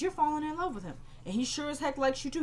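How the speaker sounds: high, knowing mock accusation; teasing accusation. Acted accusation of being in love.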